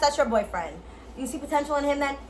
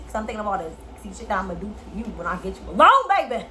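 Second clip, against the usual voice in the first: seductive voice; 4 semitones lower, faster than usual. Shown as a demonstration. Seductive demo to a lover.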